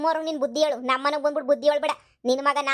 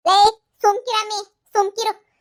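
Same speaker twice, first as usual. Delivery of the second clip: loud, slow, higher scolding; angry hush; 5 semitones higher, 6 dB louder, slower than usual. Acted. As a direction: shut her up with force. Telling the girl to be quiet.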